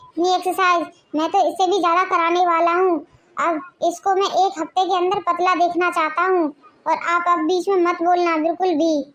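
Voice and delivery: ordinary delivery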